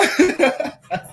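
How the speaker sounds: a short burst of real laughter. Real, not acted. Streamer laughing at own joke, real laugh.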